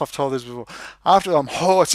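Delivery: normal talking voice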